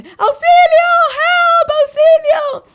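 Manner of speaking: very high, very loud cries for help; desperate screaming for help. Retold or imitated. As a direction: scream it as you run for your life.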